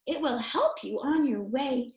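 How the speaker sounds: usual speaking voice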